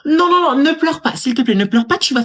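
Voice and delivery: high, flustered pleading; flustered panic. Acted. Cashier panicking to stop the girl crying.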